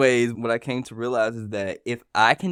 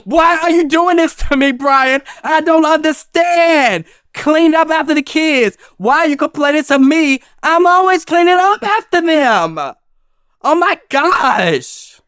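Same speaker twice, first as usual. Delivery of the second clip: much higher, louder shrill-pitched mock tirade; wife ranting at husband Brian about the chores, mock furious and much higher; mock wife's rant; 10 dB louder than usual. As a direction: play a furious wife yelling at her husband. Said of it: "do it, do it again".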